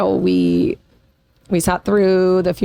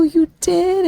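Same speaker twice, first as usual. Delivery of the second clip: much higher, amazed question; surprised mom; 11 semitones higher than usual. Retold or imitated. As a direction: ask it wide-eyed and touched. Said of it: "and I go".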